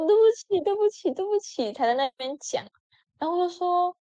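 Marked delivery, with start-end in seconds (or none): 0.1-1.7 high, pleading sorry sorry sorry; imitating a whiny apology, much higher; mock apology.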